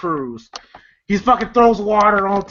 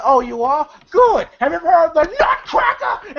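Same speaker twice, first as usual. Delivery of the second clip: raised, high-pitched gleeful threat; mock menacing taunt; 8 semitones higher than usual. Money Mike taunting Damon before squeezing, in a high mock shout. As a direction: build to a hammed-up shouted taunt.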